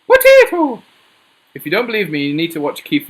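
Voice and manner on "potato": high, loud mock-Irish "Potato!"; mock Irish shout. Showing viewers how the Irish answer, in a high loud mock-Irish accent. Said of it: "they will answer you like this".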